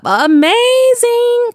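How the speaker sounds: high, stretched-out marveling "amazing"; awed delight. Real, not acted. Vlogger marveling at the disciples' power, drawn-out awe. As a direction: savour the word, slow and wowed.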